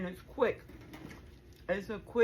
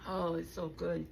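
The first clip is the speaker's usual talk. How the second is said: blissful; 4 semitones lower than usual. Real, not acted. Tasting the food.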